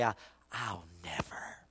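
low, whispered echo of his own vow; hushed recollection. Acted. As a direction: Peter hearing his own words, barely voiced. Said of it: "Peter heard himself say".

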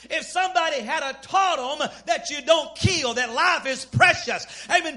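high, shouted pulpit delivery; full-force preaching. Real, not acted. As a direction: preach hard, stretching the commandments out.

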